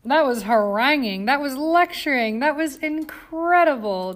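mock outrage that swells with each phrase; snorting, excited. A narrator's reading. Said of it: "Jane snorted".

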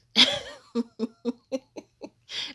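loud high laugh bursting out; burst of laughter. Real, not acted. Laughing to listeners at the fake brides, loud high laugh. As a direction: laugh out loud at how absurd it is.

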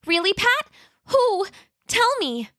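high, eager little T-Rex; Roxy eager. Acted. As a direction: beg to know, excited.

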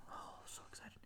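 unvoiced mock glee; excited whisper. Waiting in line for a churro, excited whisper. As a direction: whisper it as an eager child.

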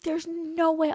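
high-pitched disbelief. Retold or imitated. To the coach, insisting nobody could hear: high disbelief. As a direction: squeak it out like it's impossible. Denying the other girl heard.

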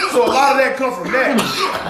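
ordinary delivery

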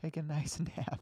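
soft robot, laughing through; calm AI voice. Retold or imitated. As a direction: lull them like a care robot. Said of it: "with, like, a calm A I voice".

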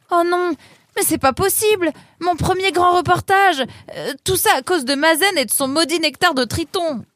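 high, loud wail of despair; young reporter lamenting a ruined trip; dismayed Nemrod. Acted.

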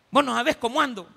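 shrill, loud complaining rant; angry shout. Acted. Preacher acting a bus passenger yelling at the fare collector. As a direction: snap at everyone, fed up.

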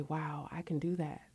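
soft whispered awe; child's awe. Retold or imitated. Voicing a child discovering they can do it, in awe. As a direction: breathe it like a wondering child. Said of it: "children to look at this and say".

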